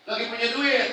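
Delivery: punya duit called out high and loud; loud preaching emphasis. Shown as a demonstration. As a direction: call it out to the crowd. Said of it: "repeated louder".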